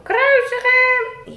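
high, slow, loud mock crowd yell; crowd shout. Puppet Rick yelling the crowd's cry, high and slow. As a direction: chant it like the mob. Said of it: "ze blijven maar roepen".

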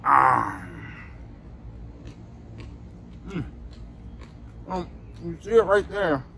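chewing while talking; muffled by chewing; mouth full of candy. Real, not acted. Seen 0: Bites the cup at 0.2, then chews with closed, working mouth.

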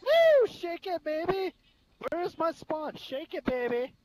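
high, loud stripper-club hype; hyped cheering. Pretend.

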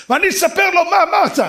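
very high, loud tattling yell; hammed-up outraged shout; shouted threat. Angry man threatens to tell the rabbi, mock shouting.

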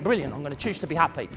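quick, quiet, naive enthusiasm; mock eager resolve. Listener imitating an eager workshop attendee, quick and naive. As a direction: rattle it off like a keen convert. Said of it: "You think".

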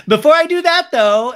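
high-pitched, shouted interruption; hammed-up cry to halt proceedings; mock loud interjection. Acts woman interrupting before exit.

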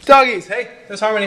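high, loud call across the yard; calling the dogs. Real, not acted.

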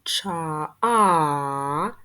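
ча- then а stretched long and loud; teacher isolating the а in чайник for children; sounding out a vowel. Shown as a demonstration. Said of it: "Другий звук".

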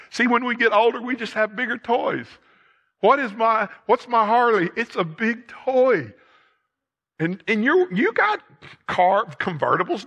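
high, forceful sermon delivery; impassioned preaching. Real, not acted. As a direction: preach it out with rising urgency.

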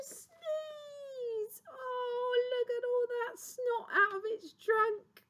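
very high, squeaky revulsion; squealing disgust. Acted. Teacher sees snot from the trunk, squealing disgust.

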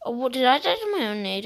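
loud, fast shocked cry; surprised outburst. Real, not acted. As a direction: blurt it out in disbelief. Dies to own grenade.

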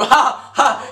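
mock laugh. Laughs at own joke.

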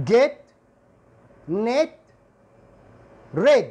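get, net, red with a short e, red punched loud; model words. Shown as a demonstration. Teacher listing example words for the short e. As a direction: list each word clearly, the last one loud. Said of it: "يعني مثل".

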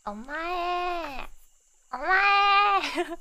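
loud high anime shouting; Subaru impression. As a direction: yell it out like an excited anime girl. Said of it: "Subaru senpai would be like".

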